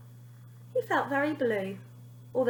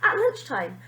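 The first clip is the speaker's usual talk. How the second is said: ordinary delivery, nothing marked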